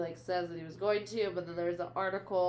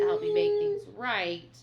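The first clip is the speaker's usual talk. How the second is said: pleading; 11 semitones higher, 7 dB louder than usual. Retold or imitated. Retold plea to her.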